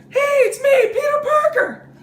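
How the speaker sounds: put-on very high-pitched, loud, excitable boy; Peter Parker. Comic mocking young Peter Parker's voice, high-pitched impression. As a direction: squeal it high and loud, like an eager kid hero. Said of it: "and he talks like this".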